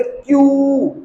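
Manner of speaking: क्यू with a long held यू, falling at the end; drawn-out pronunciation. Shown as a demonstration. Teacher showing how we will say it, drawn out. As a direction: stretch the vowel in क्यू. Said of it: "pronounce करना है".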